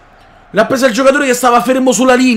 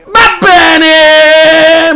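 shout; 6 semitones higher, 6 dB louder than usual. Real, not acted.

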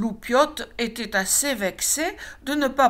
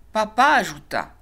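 plain reading voice

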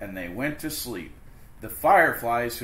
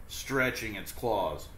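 usual speaking voice

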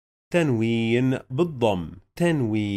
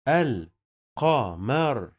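الْقَمَر broken slowly into al, qa, mar; very slow teaching pronunciation of القمر; syllable by syllable; much slower than usual. Shown as a demonstration. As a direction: sound out القمر with gaps between syllables. Teacher sounds out word by syllables.

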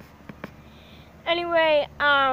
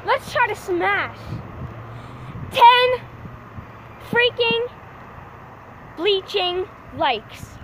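building up to a loud, slow, punched "ten"; slow and loud, pumping up viewers; hyped-up plea; 5 semitones higher, 4 dB louder, much slower than usual. Real, not acted. Hyping viewers for likes.

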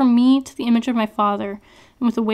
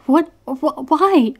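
loud, higher, stammered cry of pain; spluttering in pain; 5 semitones higher, 5 dB louder, slower than usual. A narrator's reading. Prisoner stabbed by her captor, spluttering. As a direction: sputter out the words after the stab. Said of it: "I splutter in pain".

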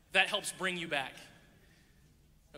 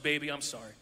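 deep-voiced singer; 7 semitones lower than usual. Bass singer apologizing to a woman mid-song, deep voice. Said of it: "he just has a really deep voice".

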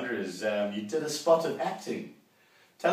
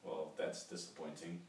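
deadpan disappointment; 8 semitones lower, 17 dB quieter than usual. Acted. Deadpan letdown.

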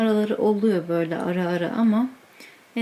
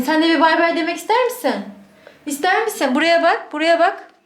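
higher, quicker coaxing; 6 semitones higher than usual. Real, not acted. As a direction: coax the dog to look at the camera. Baby talk to dog.

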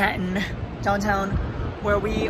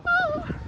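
sudden high-pitched squeal; 4 dB quieter than usual. Real, not acted. Nervous on the vessel.

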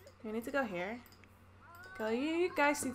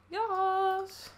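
excited; 7 semitones higher, 4 dB louder than usual. Real, not acted. Cheers success.

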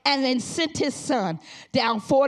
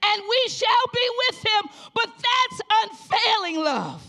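preaching at full force; 7 semitones higher than usual. Acted. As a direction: preach it out to the congregation. Preaching again.

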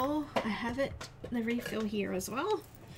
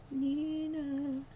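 "Nina" sung out higher, drawn out and playful; higher playful name call; 7 semitones higher than usual. Shown as a demonstration. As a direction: call "Nina" in a bright raised voice. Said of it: "I'm using Nina today".

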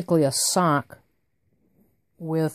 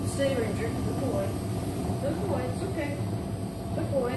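soothing a scared pet; 7 semitones higher, 5 dB quieter than usual. Real, not acted. Soothing the dog during sock fitting.

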